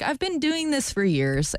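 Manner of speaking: high, slow, indignant Southern-belle huff; indignant retort. Retold or imitated. To Kate Spade over cake for breakfast, high indignant huff. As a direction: huff it back as if you'd been robbed. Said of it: "it seems very angry".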